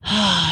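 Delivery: audible wistful sigh; breathy acted sigh. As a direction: let out a long airy sigh. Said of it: "Ellen sighed".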